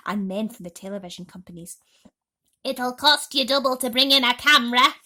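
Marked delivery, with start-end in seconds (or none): 2.6-4.9 loud, greedy shout. Acted. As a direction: bark the price at the cameramen. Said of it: "Aunt Spiker shouted".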